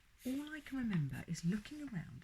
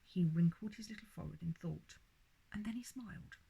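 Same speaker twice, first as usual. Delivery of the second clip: ordinary delivery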